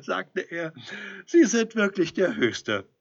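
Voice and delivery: a devil laughing at a naive mortal; mocking devil. A narrator's reading. Devil mocks Edelstein, laughing. (spöttischer, lauter Teufel)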